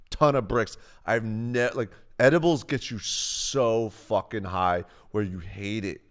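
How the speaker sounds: normal talking voice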